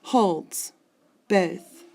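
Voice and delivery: "Holds", pause, "both", each its own beat; teacher counting "Holds" and "both" as syllables; syllable-pair scansion. Shown as a demonstration. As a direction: punch out "Holds ... both" separately. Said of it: "there are my two syllables".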